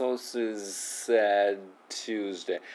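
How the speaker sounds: very slow "Sources said Tuesday", D just held into T; slow linked phrase. Shown as a demonstration. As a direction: drag it out, no release on the D. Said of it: "そうね".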